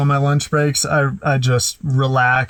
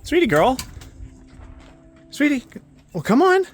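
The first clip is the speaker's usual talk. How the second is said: much higher sing-song voice for the dog; high pet-talk voice; 13 semitones higher than usual. Real, not acted. Owner calling her dog in a much higher cooing voice. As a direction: coo up high like talking to a puppy.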